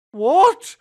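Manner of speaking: high, loud shocked demand; sharp loud what. A narrator's reading. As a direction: son outraged at the news. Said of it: "my son demanded".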